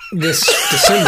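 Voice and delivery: breaking into laughter mid-word; cracking up; laughing. Real, not acted. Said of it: "I was taking a sip of water".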